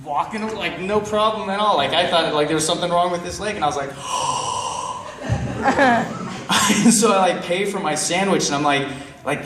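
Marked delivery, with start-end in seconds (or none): 3.9-6.8 acted gasp of shock. Storyteller sees the limping beggar walk fine, shocked gasp. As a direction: gasp long, as if you just caught him out.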